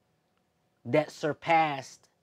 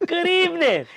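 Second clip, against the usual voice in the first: laughing mock greeting; 6 dB louder than usual.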